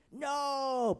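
high, loud, drawn-out no; loud insistent denial; insistent protest. Acted. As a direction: cry out in firm refusal. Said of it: "Peter insisted".

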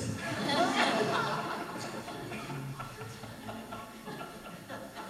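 breathy burst of real laughter at their own mix-up; a long amused laugh. Real, not acted. Laughs at their email mistake.

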